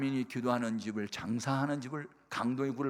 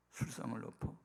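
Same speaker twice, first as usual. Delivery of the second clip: inner whisper; 8 dB quieter than usual. Retold or imitated. Inner doubt after mother cries.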